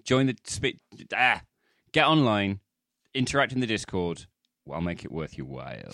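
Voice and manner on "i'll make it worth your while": seductive. Pretend. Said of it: "All right, [name], calm down".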